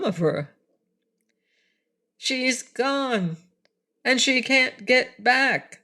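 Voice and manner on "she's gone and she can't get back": high, slightly slow creature voice; lightly voiced odd beast, glum; high, slower Woozy saying she's gone; Woozy's voice. A narrator's reading. Woozy speaks in the story.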